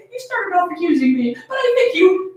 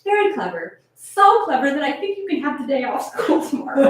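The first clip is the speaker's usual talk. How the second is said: lower, gentle praise; fond praise; 6 semitones lower, 3 dB quieter than usual. Acted. Mother praising her clever daughter, low and fond. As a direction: reward the child warmly, voice dropped low.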